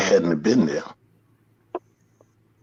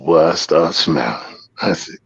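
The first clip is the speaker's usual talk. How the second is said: usual speaking voice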